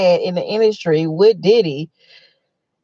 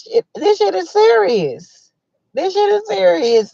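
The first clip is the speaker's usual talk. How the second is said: indignant; 11 semitones higher than usual. Real, not acted. Outraged about [name].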